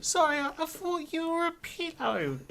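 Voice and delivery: very high, squeaky character voice; apologetic Gax. Acted. Gax to Spintles after mistaking knees for pillow, squeaky apology. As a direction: stammer an apology in a tiny high voice.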